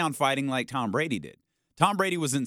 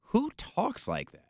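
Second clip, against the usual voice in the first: slow, higher, incredulous question; slow mock incredulity; disbelieving; 8 semitones higher, slower than usual. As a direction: ask it like it's absurd. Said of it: "you read it like".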